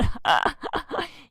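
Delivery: a high happy laugh; real amused laugh; light laugh. Real, not acted. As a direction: chuckle at the frog game. Laughing after frog.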